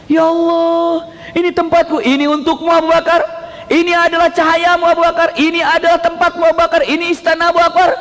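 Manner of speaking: high, overwhelmed exclamations; high, sustained fervent wonder; tearful awe. Retold or imitated. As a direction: imitate someone moved at seeing paradise. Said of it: "Berlinang air mata Abu Bakar".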